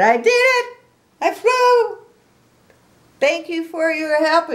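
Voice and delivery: very high, loud squeal of triumph; Piggy's squeaky joy. A narrator's reading. Reader voicing Piggy to children, very high excited squeal. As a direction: squeal it like a thrilled little pig. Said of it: "And he said".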